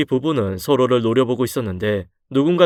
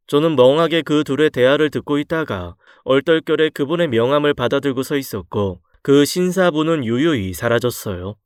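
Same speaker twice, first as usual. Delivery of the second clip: usual speaking voice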